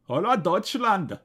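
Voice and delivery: Hurrah Deutschland in a hammy German accent, HOLAH TOYTSHLAND. Shown as a demonstration. Redoing the title in a joking German accent. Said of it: "That, that was my accent".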